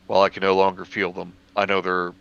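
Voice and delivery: ordinary delivery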